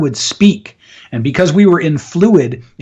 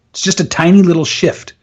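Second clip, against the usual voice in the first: normal talking voice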